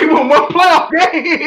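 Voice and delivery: high, slow, laughing jeer; laughing scorn. Real, not acted. Fan mocking one playoff win, laughing scorn. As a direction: stretch it out high through a laugh. Scoffing at team's playoff record.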